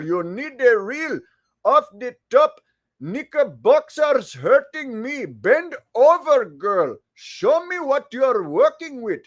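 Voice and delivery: put-on jokey Indian accent read. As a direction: rap it in a hammed-up Indian accent. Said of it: "Indian accent to keep it comedy".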